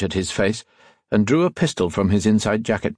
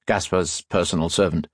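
usual speaking voice

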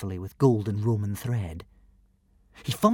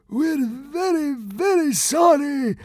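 high, slow frightened consul; stammering; 3 dB louder, slower than usual. Acted. Fat consul stammering his apology to the god. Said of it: "stammered the fat consul".

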